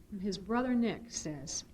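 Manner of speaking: usual speaking voice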